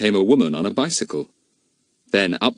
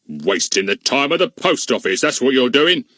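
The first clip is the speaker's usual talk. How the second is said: grumpy policeman; 5 semitones higher than usual. A narrator's reading. Goon scolds.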